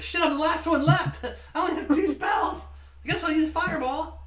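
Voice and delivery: high, loud, laughing panic; panicked yelling. Retold or imitated. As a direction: yell it like the last one standing. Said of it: "he's like".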